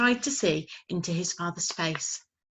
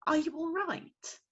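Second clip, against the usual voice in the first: put-on sweet young voice; 10 semitones higher than usual. Young lady soothing the fallen owlet. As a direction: comfort a fallen chick.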